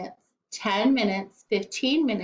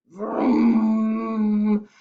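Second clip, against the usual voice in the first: a revving engine noise, vroom; car vroom; 3 dB louder than usual. Acted. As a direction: make the car roar away. Car driving off sound.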